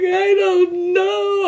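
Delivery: drawn-out, slow, high and broken; high sobbing voice. Retold or imitated. Answering her best friend's question, slow high sob. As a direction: let it sob out slowly.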